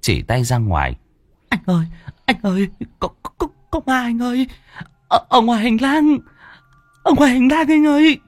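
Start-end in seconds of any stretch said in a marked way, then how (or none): 1.5-8.2 much higher, stammering panicked voice; terrified stammer. A narrator's reading. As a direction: stutter out the ghost sighting in terror. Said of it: "Hoa ngồi bó gối".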